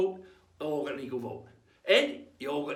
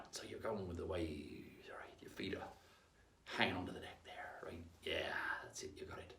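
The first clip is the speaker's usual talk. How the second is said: quiet; 11 semitones lower, 15 dB quieter than usual. Acted. Coaching pirate stance softly.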